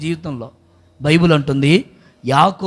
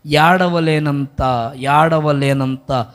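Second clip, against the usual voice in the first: very slow, stretched words; low, slow, weighed-down; slow and drawn out; 4 semitones lower, much slower than usual. Real, not acted. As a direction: let the heaviness sink in. Slow emphatic sermon phrase.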